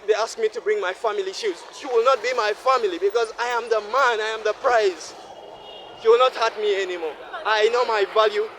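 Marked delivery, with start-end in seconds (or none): none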